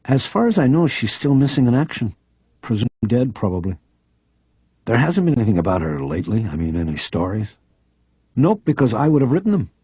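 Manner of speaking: a keen journalist pressing a friend; eager female reporter. Acted. Reporter urging Harry to stay on the phone, insistent.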